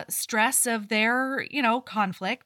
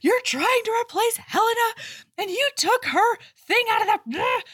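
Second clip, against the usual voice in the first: higher, louder, voice pitched up in hurt anger; accusing outburst; 12 semitones higher, 3 dB louder than usual. Retold or imitated. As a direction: hurl the accusation at the new wife. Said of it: "Edith is like".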